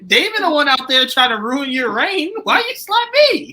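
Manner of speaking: loud, high-pitched imitated complaint; hammed-up outraged whine at full volume; mock indignant protest. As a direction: protest like the wronged slap victim. Voices slapped man's protest.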